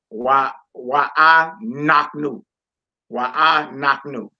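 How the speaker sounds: slow syllables with the weight on "knock"; stress on KNOCK. Shown as a demonstration. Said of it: "that's what you accent".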